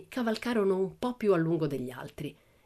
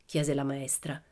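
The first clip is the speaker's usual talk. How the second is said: usual speaking voice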